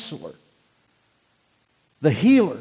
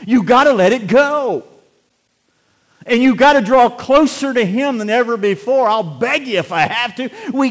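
loud, high, pleading sermon voice; fervent preaching; 10 semitones higher, 12 dB louder than usual. Acted. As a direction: urge them hard to let go. Preaching at full force.

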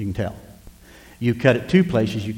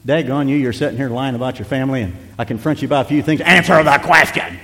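hammed-up rebuke building to a loud bark; scold, then stretch the last demand out loud; mock scolding; 2 semitones higher, 5 dB louder than usual. What Jesus didn't say to the woman, mock-angry interrogation. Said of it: "no, that's not what he did".

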